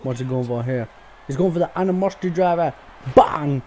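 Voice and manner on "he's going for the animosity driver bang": fast, higher and louder, then a loud bang; hyped play-by-play. Real, not acted. Calling the finisher in his wrestling game, fast and loud. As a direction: rush the call as the move lands.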